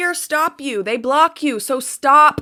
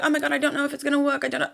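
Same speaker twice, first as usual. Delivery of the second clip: anxious doubter; 7 dB quieter, much faster than usual. Retold or imitated. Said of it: "you're like".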